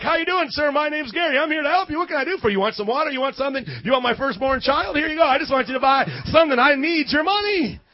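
butter up the rich customer; fawning salesman. Retold or imitated. Salesman Gary gushing over the man from the Hummer. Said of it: "you're like".

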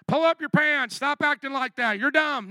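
mock the preacher who just yells; harsh scolding. Shown as a demonstration. Demo of bad preaching at the young, harsh and loud. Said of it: "did I just preach like that?".

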